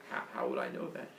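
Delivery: hushed, low, hesitant mumble; timid reply. Acted. Villager answers boastful Arachne, timid acted hush. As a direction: back off softly, almost whispering.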